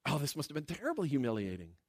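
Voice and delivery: normal talking voice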